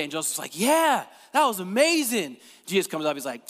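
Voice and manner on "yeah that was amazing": high, loud gushing; playful mock excitement; enthusiastic agreement. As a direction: gush with hammed-up enthusiasm, voice swooping up. Joseph enthusing in brunch skit.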